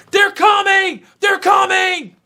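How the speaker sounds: high, loud warning shout; frantic shout. Retold or imitated. Guide shouting to the group that enemies are coming, frantic high shout. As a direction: yell the alarm as the danger nears. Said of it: "the guy yells".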